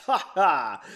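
loud, hearty stage laugh; hammed-up jovial ha ha; acted laugh. A narrator's reading. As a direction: laugh it out in two big beats. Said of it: "the general laughed".

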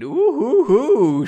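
high, loud "ooh-hoo-hoo"; mock suggestive hoot. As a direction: whoop it teasingly. Suggestive hoot in story dialogue.

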